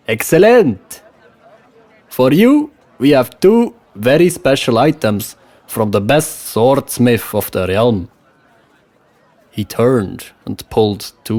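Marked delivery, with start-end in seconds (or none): 0.1-8.1 put-on West Frankish accent. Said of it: "with a West Frankish accent".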